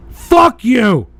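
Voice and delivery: loud, sharp yell of contempt; angry shout. Real, not acted. Streamer yelling at a chatter, angry shout. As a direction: bark it straight at him.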